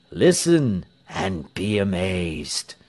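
slow, low announcement; portentous pastor. A narrator's reading. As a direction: hold the crowd in suspense. Pastor builds up momentous news.

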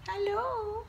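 pet voice. Real, not acted. Greeting a dog.

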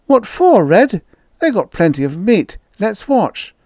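put-on a young boy pleading to stay; boy's voice, eager and high; high boyish Slim voice. A narrator's reading. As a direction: kid begging to watch.

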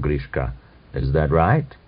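ordinary delivery

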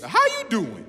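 high-pitched talk-show catchphrase; playful celebrity catchphrase impression; Wendy Williams. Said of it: "you know Wendy Williams".

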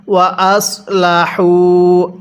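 ওয়া আসলাহু slowly with the heavy sad s; careful tajweed ওয়া আসলাহু; correct sad sound. Shown as a demonstration. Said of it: "আরেকবার পড়ুন".

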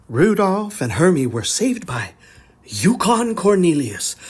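ordinary delivery, nothing marked

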